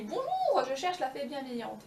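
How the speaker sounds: very high, sweet greeting; polite little girl. Acted. Blondine greets the lady.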